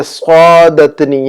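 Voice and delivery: سعادتنی slowly, with a long heavy first syllable "swaa"; pronunciation model. Shown as a demonstration. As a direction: stretch the opening of سعادتنی. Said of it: "ہم وہاں پر کہیں گے".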